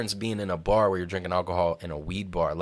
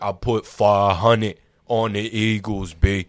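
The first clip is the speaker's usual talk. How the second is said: slow, louder New York drawl through a laugh; laughing New York bettor; 2 semitones higher, 9 dB louder, much slower than usual. Retold or imitated. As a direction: brag about the bet like an old New Yorker. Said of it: "He's like".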